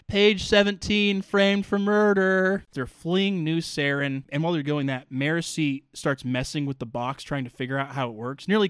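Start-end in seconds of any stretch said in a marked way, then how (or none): 0.1-2.6 slow, loud holler from a window; mock calling out. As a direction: imitate someone shouting back while fleeing, drawn out. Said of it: "stick her head out the window and says".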